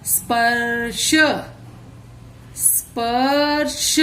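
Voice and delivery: स्पर्श read slowly with the रेफ र clear; स्पर्श stretched, the र before श audible; slow careful reading. Shown as a demonstration. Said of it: "र, रेफ".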